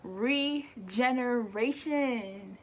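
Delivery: usual speaking voice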